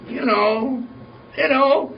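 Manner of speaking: high, rising mock-coy hint; awkward hinting. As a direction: imitate a suitor hinting awkwardly, voice climbing.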